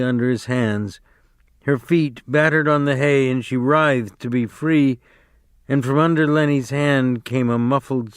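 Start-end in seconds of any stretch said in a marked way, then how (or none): none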